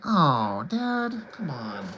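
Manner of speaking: put-on soft, drawn-out kid's protest; long complaining groan of a child to a parent; whining kid. Boy protesting to dad over the movie, soft drawn-out whine. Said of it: "I was like".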